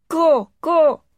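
high, loud cawing crow voice; hoarse crow. A narrator's reading. Crow calls out to the departing gardener, hoarse cawing. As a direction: caw it out like a crow, hoarse but not rude. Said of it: "remarked the crow hoarsely".

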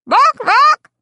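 high loud imitated dog barks; fierce barking. Dog swipes at rooster.

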